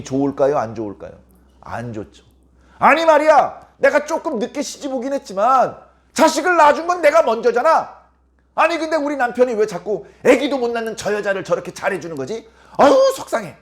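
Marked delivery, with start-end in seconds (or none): 2.8-13.6 higher, louder, whiny complaint of a jealous second wife; jealous co-wife grumbling. Acted. Jealous wife complaining about her husband's favoritism, whiny and raised. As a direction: grumble it out like a resentful rival wife.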